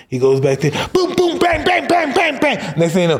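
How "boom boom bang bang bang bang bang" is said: high imitated banging; mock beating noises. Imitating [name] beating the band, high. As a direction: bang it out like blows.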